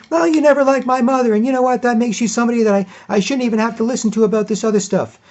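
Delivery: hammed-up girlfriend piling on blame; mock whiny girlfriend. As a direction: lean hard on "never" in a high whine. Girlfriend voiced in argument.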